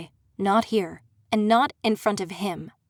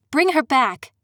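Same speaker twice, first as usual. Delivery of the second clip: higher and louder, firm command; ordering the prince to return her friend; angry demand; 4 semitones higher, 4 dB louder than usual. Acted. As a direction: demand it, no room for refusal. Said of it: "I demanded".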